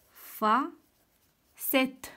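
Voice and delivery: "فا", long pause, then "سيت"; syllable by syllable. Shown as a demonstration. Teacher placing the word in the table, "فا سيت" broken in two. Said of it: "كنسمع اس".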